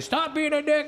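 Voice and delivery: high-pitched imitation of angry brother; brother yelling. Brother scolding him at the deathbed, yelling. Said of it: "My brother's yelling at me".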